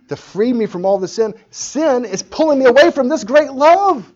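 building to a high, full shout; impassioned outcry. Acted. A believer crying out against sin, impassioned shout. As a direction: climb to a very loud, high cry.

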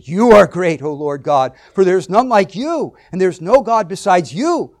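each "you" punched out loud; stress on YOU. Shown as a demonstration. Preacher reading David's praise of God, "you" heavily stressed. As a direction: throw full weight on every "you". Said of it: "put emphasis on certain words".

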